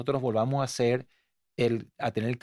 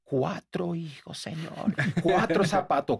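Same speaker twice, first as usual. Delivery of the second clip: panicked prayer; 3 semitones higher, slower than usual. Retold or imitated. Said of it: "y temblaba así".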